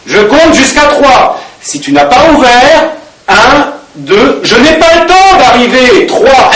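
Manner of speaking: high, loud, slow menacing count; threatening countdown. Retold or imitated. Stepmother counting to three outside the girl's door, angry.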